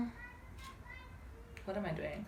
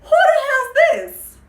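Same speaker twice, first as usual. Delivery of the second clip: laughing mock shriek; 12 dB louder than usual. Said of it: "She's asking him".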